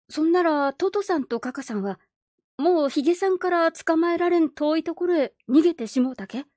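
put-on high, eager little boy; child voice. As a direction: ask like an anxious child. Child asks grandfather.

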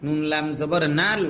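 ordinary talk, nothing marked

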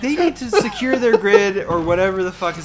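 high, loud exasperated rant. Acted. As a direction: rant like you've had enough. Ranting about grid leaks in game.

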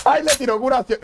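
high, wailing exclamation; emotional outcry. Real, not acted. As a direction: cry out high at a touching sight. Moved as a heal is thrown.

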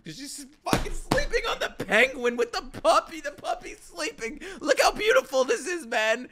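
high-pitched squeal of adoration; overwhelmed gushing. Real, not acted. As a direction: gush helplessly over something adorable. Overwhelmed by sleeping puppy scene.

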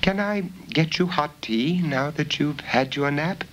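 put-on a high, eager young girl's voice; young girl Bella. Bella offering tea.

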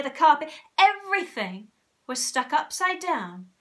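put-on emphatic reading aloud to children; animated story narration. A narrator's reading. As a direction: build the list with mounting amazement.

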